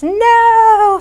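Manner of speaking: high, loud screamed refusal; a defiant child screaming no at mom. Acted. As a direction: shriek it like a defiant kid. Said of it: "they're screaming and yelling".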